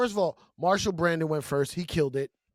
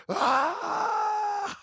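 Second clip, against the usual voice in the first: high, drawn-out "aah" cheer; imitated crowd roar. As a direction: mimic an arena erupting.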